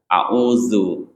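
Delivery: a'udzu with a voiced z, tongue tip at the upper teeth; correct za. Shown as a demonstration. Teacher modelling the dotted za, careful and correct. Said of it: "za ada titiknya di atas".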